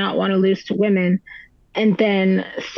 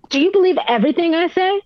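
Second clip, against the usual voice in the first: imitating his snappish, higher-pitched retort; mock indignant challenge, pitched up; retold snap; 8 semitones higher, faster than usual. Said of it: "he snaps at me and says".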